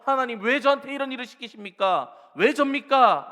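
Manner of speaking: loud aggrieved why me; complaining cry. Acted. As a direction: protest to God with force. Complaining prayer voiced loudly.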